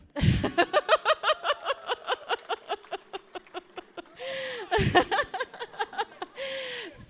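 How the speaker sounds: very long, high, loud laughing fit; real helpless cackle. Real, not acted. Auntie reveals family underwear run, long helpless cackle. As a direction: crack yourself up and keep cackling.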